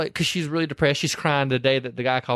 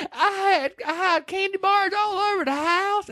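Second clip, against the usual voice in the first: very high, whiny confession; Evelyn crying. Retold or imitated. Retelling Evelyn crying to her husband, high and whiny. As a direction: whimper it out like a sheepish wail.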